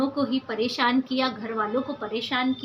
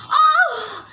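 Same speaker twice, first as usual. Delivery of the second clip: high, loud gasping cry; shocked cry at the gunfire; startled cry; 11 semitones higher, 6 dB louder than usual. Acted. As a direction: yelp as shots ring out. Reacting to gunfire.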